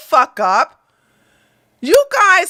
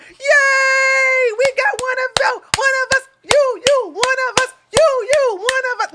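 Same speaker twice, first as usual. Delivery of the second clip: high squealing mock cheer, you, you, one of us; mock cheering fan; 11 semitones higher than usual. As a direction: squeal like a delighted fan welcoming a newcomer. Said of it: "And you're like".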